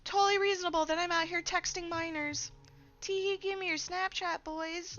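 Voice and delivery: very high, put-on simpering voice; high, cutesy parody of a creep; mock creep voice. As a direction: squeal it in a mocking falsetto. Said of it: "am I gonna be like".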